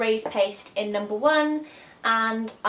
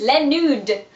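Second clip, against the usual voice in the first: playful French pronunciation, louder; mock French accent; 3 semitones higher, 6 dB louder than usual. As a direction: put on a French accent for the name.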